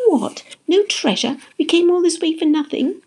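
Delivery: high, incredulous questions; indignant disbelief. A narrator's reading. Jessie learning there is no treasure, incredulous. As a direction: protest in shocked disappointment. Friends learn X is only on map.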